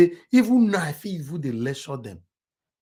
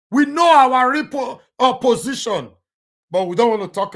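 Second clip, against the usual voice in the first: emphatic declaration; 9 semitones higher, 7 dB louder than usual. Real, not acted.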